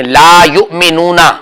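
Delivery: slow, drawn-out ला युमिनूना; slow recitation. Shown as a demonstration. Teacher reading the word slowly for a halting student. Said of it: "फिर दोबारा बोले".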